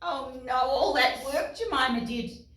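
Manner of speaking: usual speaking voice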